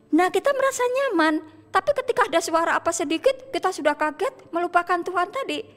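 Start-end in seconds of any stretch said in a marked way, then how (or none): none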